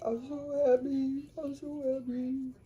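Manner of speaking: high, teary, overjoyed; tearful joy. Retold or imitated. Mom hearing her husband is saved, high tearful happy voice. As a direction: imitate mom crying with happiness, pitched high. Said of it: "my mom started crying".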